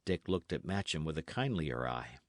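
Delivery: usual speaking voice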